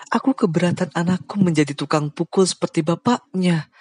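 high, touched, voice catching; moved to tears with gratitude; Aunt Kim choking up. Acted. Aunt Kim thanking Bujang, voice catching with emotion. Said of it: "Suara Bibi Kim tersendat".

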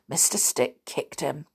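plain reading voice

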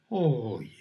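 whispered, pitiful and low; plaintive. Shown as a demonstration. Teacher demonstrating a plaintive tone, whispered. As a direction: plead softly, hurt.

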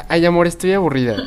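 voz aguda de novia quejumbrosa; quejándose con tono mimado; novia aburrida. Retold or imitated. Pareja aburrida en el encierro. (novia aburrida y mimosa)

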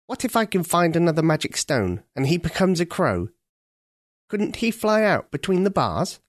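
a clever crow thinking aloud; senior crow. Acted. Senior crow suggesting a second stone.

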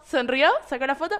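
normal talking voice

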